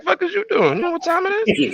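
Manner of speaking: high-pitched mock indignation; disgruntled dragon. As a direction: demand an explanation as a put-out dragon. Repeats the disgruntled dragon's reaction.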